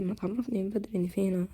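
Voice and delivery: soft unvoiced grumble; whisper. Real, not acted. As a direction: whisper the complaint. Quiet aside. (همس متذمر)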